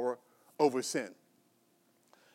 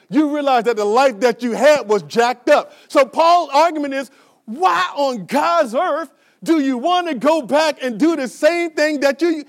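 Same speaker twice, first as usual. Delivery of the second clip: full-force preaching; 5 semitones higher, 8 dB louder than usual. Acted.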